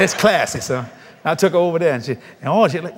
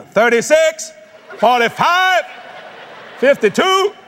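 loud, high counter call of order numbers; 8 semitones higher, 3 dB louder, slower than usual. Retold or imitated. Restaurant worker calls order numbers loudly. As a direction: call the numbers across a packed room.

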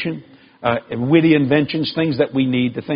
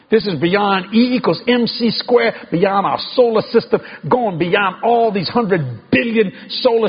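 stack each word higher, preaching the vastness; building sermon climax; 6 semitones higher than usual. Acted. Preacher stirring the congregation, building preaching climax. Preacher climbing through a list.

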